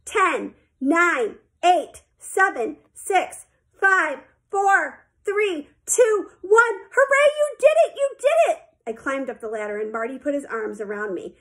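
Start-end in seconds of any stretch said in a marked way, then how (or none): 0.0-6.8 countdown climbing steadily in pitch and excitement; counting out loud. A narrator's reading. Coach counting down the last seconds, building excitement. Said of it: "Marty counted out loud". 6.9-8.6 very high, loud cheering; jubilant. A narrator's reading. Coach cheering as Sheila finishes, jubilant. Coach celebrates her success.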